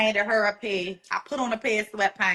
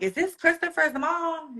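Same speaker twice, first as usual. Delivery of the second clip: a touch higher, a light voice for the caller; brisk caller voice; 8 semitones higher than usual. Retold or imitated. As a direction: lightly voice the calling lady, asking. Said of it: "the lady's like".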